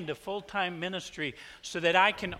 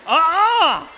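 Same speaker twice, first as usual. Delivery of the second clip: much higher, loud playful shout; shouted wave-off; 10 semitones higher, 13 dB louder than usual. Real, not acted. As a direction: wave off the applause loudly. Preacher waves off applause.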